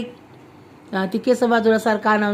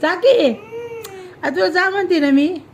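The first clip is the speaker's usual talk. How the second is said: higher-pitched scolding push; exasperated order; 8 semitones higher than usual. Real, not acted. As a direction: say it higher, fed up, to make her go. Exasperated mother ordering child. (मुलीला वर जायला सांगणारी वैतागलेली आई)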